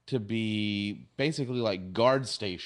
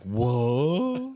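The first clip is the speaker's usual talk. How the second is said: drawn-out, rising mock whoa; dazed whoa; 4 dB louder than usual. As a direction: play a wide-eyed, drawn-out "whoa". Data imagined hanging upside down.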